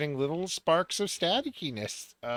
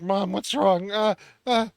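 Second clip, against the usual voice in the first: panicked; 7 semitones higher, 6 dB louder than usual. Acted. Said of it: "wanna just freaking out, like".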